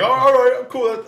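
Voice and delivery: high-pitched sarcastic agreement; mock impressed.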